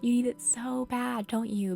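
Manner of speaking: ordinary delivery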